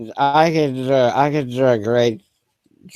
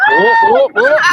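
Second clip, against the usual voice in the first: loud whoop; 8 dB louder, much slower than usual. Real, not acted. Whoops at a chair mishap.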